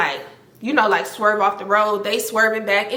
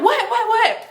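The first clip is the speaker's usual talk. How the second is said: high, laughing panic; startled and giggling; laughing startled what; 12 semitones higher, slower than usual. Retold or imitated. Her startled reply, laughing.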